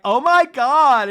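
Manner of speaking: high, loud mock-tearful wail; drawn-out melodramatic cry of dismay; Lisa. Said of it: "like, like, tearing up".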